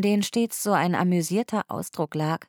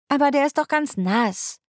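much higher, louder complaining; whiny protest; 9 semitones higher, 4 dB louder than usual. A narrator's reading. Polly protesting to Huckle about her wet coat, whiny. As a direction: whine it like a reluctant child.

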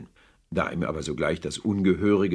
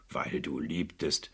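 contemptuous grumble; 5 dB quieter, slower than usual. Acted. Scrooge mocks his nephew's reason for marrying, slow soft grumble. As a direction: sneer it under your breath, slowly. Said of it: "brummte Scrooge".

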